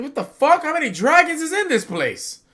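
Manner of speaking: high, loud, incredulous yelling; mock shocked yell. Fan retells the admiral freaking out, loud mock yell. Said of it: "He said".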